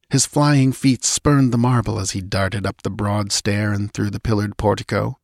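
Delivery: usual speaking voice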